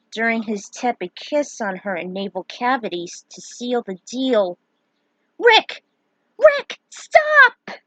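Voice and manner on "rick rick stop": high, loud pleading cries of his name; frantic high shouted plea; desperate screams. Acted. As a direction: scream at him to stop, voices from inside his head. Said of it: "screamed in his subconscious".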